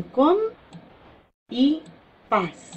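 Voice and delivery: "com y paz" with long gaps between the pieces; split into syllables. Shown as a demonstration. Teacher dividing compás for students, "com y paz" syllable by syllable. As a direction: separate "com" and "paz" as two isolated syllables. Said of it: "se divide en".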